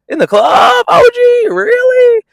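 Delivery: shouted high, disbelieving; incredulous shout. Real, not acted. Recapping the fight, she shouts her disbelief at Jayla.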